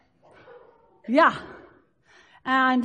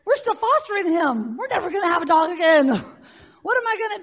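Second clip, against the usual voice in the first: mock panic; 6 semitones higher, 4 dB louder, faster than usual. Her own panic retold.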